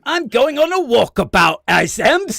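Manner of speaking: loud, high, over-the-top Australian; bad Aussie accent. Podcaster carrying on while co-host begs for help, bad Aussie accent. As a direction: holler like a hammed-up Aussie. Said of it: "try and do an Aussie accent".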